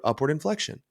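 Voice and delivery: rising tune on "upward inflection". Shown as a demonstration. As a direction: lift the pitch through "upward inflection" to sound curious. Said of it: "I might talk in an upward".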